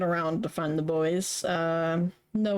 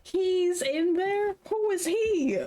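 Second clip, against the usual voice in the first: confused; 9 semitones higher than usual. Acted. Confused character reacting loudly.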